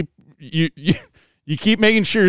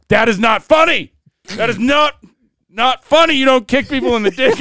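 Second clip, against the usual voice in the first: retold indignant telling-off, pitched higher; mock angry scolding; 7 semitones higher than usual. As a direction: imitate a mad uncle scolding a kid, emphatic. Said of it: "he was really mad".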